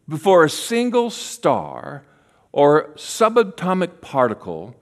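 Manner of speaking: slow recitation with long pauses; reading a poem. Real, not acted. Preacher reading the poem they wrote, slow recitation. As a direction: recite it slowly, line by line. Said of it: "I'm gonna read it to you".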